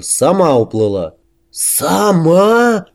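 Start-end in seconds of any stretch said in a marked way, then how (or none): 1.5-2.9 high, drawn-out incredulous echo; astonished gasp. A narrator's reading. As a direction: gasp it like an amazed crowd. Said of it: "дружно охнула толпа". (изумлённо ахнуть)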